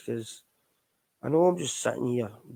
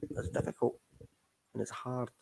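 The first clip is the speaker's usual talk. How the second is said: sinking quiet; 8 dB quieter than usual. Real, not acted. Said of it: "and I'm laughing, but".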